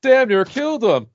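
loud, high joke punchline; imitated punchline teller. [name] at a table of bikers, loud punchline delivery. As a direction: belt out the punchline over the bar. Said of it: "you get just the punchline".

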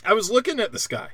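usual speaking voice

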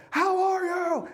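high-pitched pretend shout; drawn-out, hammed-up holler far above normal pitch; mock yelling. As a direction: yell it comically, like all caps read aloud. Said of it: "imagine him yelling everything".

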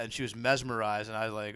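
ordinary delivery

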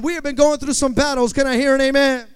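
very loud, booming congregation call; insistent amen call. Real, not acted. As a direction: push the amen call to top volume. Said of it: "I'm gonna ask you one more time".

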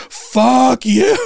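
high, drawn-out mock yell; retold outburst. Answering protesters who screamed repent, mock yell. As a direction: imitate your own furious outburst, played for laughs. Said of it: "this is what really set me off".

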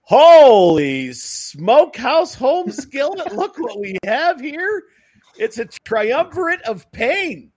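high, loud and slow showman; excited exclamation. Acted. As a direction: hype it up, drawn out. Hyping a guest's arrival.

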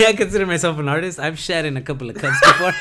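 shouting with laughter; laughing through it. Real, not acted. Comic joking to his co-host, shouting with laughter. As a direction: crack yourself up on the joke.

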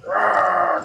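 loud throaty monster roar; big beastly bellow; imitated creature roar. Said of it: "does this cry".

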